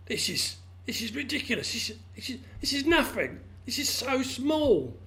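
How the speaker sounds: outraged frog cheated of his lake; indignant disbelief. Acted. Well frog finds tiny puddle.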